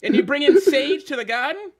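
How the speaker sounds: taunting Boston fan. Pretend. Mock Boston fan taunting Kyrie.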